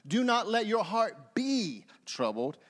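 "be" stretched out before the last word; stress on BE. Shown as a demonstration. Preacher showing a new truth per word, stress on "be". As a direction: hold the word "be" and set it apart. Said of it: "when you emphasize a different word".

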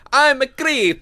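high-pitched mock Italian accent; hammed-up Italian take on a song lyric. Cohost imagines the singer turned Italian, mock Italian accent. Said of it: "are in Italian accents".

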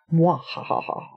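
mock evil villain laugh; mad scientist. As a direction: cackle like a scheming mad scientist. Gloating about cephalopods taking over world.

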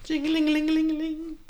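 high sing-song jingle; bell jingle. Acted. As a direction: chime like a shop bell. Imitated store bell.

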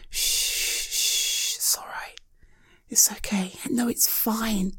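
very soft whispered shushing to calm someone; soothing shush. Acted. Friend holding a crying friend and calming her, soft whispered shushing. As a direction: hush her tears tenderly.

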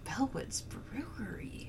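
soft whisper. Pretend. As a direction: breathe the name in a whisper. Whispering the brewery name.

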